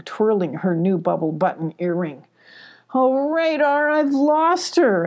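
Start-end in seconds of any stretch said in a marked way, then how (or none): 2.9-5.1 high, slow wail of dismay; acted high-pitched lament; distressed cry. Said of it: "Abby cried".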